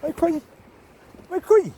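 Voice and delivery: high, loud call; calling the dog. Real, not acted. Owner calls to the dog Quiggy, loud.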